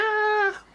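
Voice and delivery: high panicked yelp; mock frantic yell. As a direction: cry out like a stressed coworker. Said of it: "people are like".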